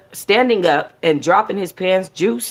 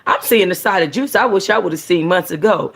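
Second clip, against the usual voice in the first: usual speaking voice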